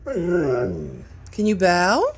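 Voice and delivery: slow, drawn-out pleading ask; drawn-out coax. Shown as a demonstration. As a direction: stretch it out to coax the dog. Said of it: "Can you show Mommy bow?".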